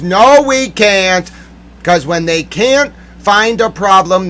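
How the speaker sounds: high, loud, slow contradiction; angry rebuttal. Real, not acted. Answering those who said it's cool, angry. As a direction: drag it out in loud indignation.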